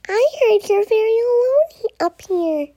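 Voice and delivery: high, concerned unicorn voice; cheerful little unicorn. Acted. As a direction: pitch it up as a friendly unicorn. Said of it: "a little unicorn".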